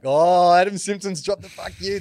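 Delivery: loud, high delighted cry; excited shout. Retold or imitated. Player reacting to the coach's swearing, delighted shout.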